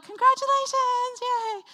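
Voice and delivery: high, loud gushing cheer; hammed-up celebratory squeal; imitated cheering. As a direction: congratulate with put-on excitement. Acts out greeters cheering at finish.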